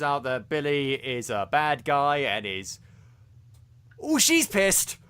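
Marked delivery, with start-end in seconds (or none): 4.0-5.0 high, exclaimed, growing louder; loud, gleeful emphasis on someone's fury; emphatic relish. Real, not acted. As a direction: draw it out slowly, then punch it louder. Emphatic reaction to the character's anger.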